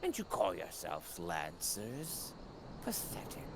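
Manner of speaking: low, menacing villain. Acted. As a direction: sneer it like a villain. Voicing game villain.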